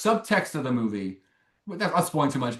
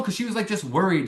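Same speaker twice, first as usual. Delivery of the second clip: ordinary talk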